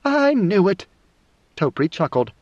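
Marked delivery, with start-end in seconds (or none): 0.0-0.9 high, exultant outburst; pitched well up in triumph; gleeful. A narrator's reading. Siona delighted at Nayla's confession, gleeful. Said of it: "clapped her hands in glee and laughed".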